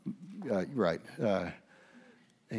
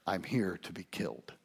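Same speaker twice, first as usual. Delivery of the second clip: solemn whisper; 3 semitones lower, 5 dB quieter than usual. Retold or imitated. Solemn aside of Jesus.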